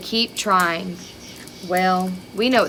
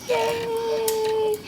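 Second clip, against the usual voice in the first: cheer; 12 semitones higher, 5 dB quieter than usual. Acted. Pilgrims' happy dance cheer.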